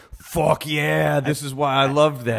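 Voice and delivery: excited cheer. Retold or imitated. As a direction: cheer like a delighted fan. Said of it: "you were like".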